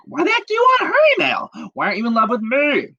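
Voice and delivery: high, fast, upset whine; jealous girlfriend. Retold or imitated. Magdalena demanding answers from Ronaldo, high and whiny. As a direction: squeal it like a hurt girlfriend. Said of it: "she was like".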